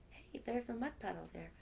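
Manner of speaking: ordinary delivery, nothing marked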